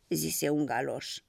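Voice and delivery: ordinary delivery, nothing marked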